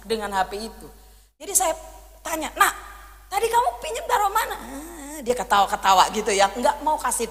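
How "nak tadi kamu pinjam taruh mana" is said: put-on high, quick worried asking of a child; pressing mother's question. Mother questions her small son about the hidden phone, quick and high. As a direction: urge the kid to tell, fast and high.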